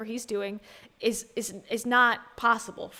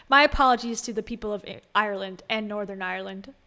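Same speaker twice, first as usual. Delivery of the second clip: usual speaking voice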